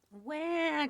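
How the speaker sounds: high, drawn-out hungry wail; baby cry. A narrator's reading. Baby ghost hungry again, high crying wail. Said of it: "cried Baby Ghost".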